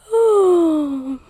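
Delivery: high, loud, falling sigh; tired sigh. A narrator's reading. Goldilocks very tired by this time, a weary sigh. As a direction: sigh long and wearily.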